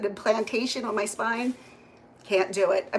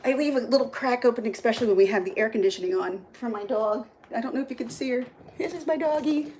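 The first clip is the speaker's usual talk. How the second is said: ordinary talk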